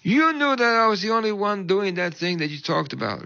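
high indignant voice, easing down towards the end; livid accusation. Retold or imitated. Upset woman accusing the pastor of singling her out, mock livid. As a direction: imitate an aggrieved, high-pitched complaint. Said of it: "She was livid".

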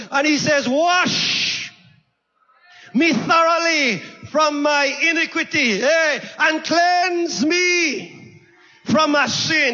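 full-force sermon delivery with long-stretched key words; impassioned preaching. Real, not acted. Preacher telling the church of David pleading with God, impassioned. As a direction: preach it hard to the congregation, drawing out the big words.

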